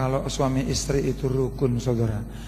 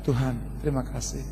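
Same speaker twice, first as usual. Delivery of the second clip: quiet tearful prayer; 6 dB quieter than usual. Retold or imitated. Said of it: "Dan saya katakan".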